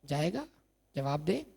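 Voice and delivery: ordinary talk, nothing marked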